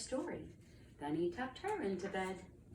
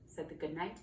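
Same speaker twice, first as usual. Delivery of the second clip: ordinary delivery, nothing marked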